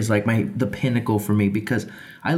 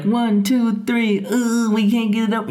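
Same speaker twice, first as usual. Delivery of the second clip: fake straining; 10 semitones higher than usual. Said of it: "we're like".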